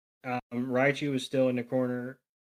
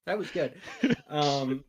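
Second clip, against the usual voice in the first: ordinary delivery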